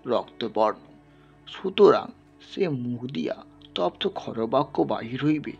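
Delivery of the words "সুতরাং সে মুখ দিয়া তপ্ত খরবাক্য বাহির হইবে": usual speaking voice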